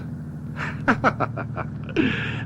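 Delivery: soft breathy bitter laugh. Acted. Murderer alone at the wreck, soft bitter laugh. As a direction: chuckle darkly to yourself.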